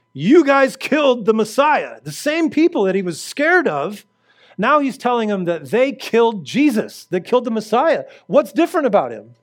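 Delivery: fiery preacher voicing Peter; fiery preaching. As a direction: thunder it at the crowd. Preaching Peter's bold sermon.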